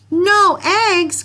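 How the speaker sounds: very high, loud outcry; crying out. Acted. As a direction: shout it in despair as the farmer. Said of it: "cried Farmer Brown".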